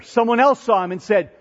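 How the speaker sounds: normal talking voice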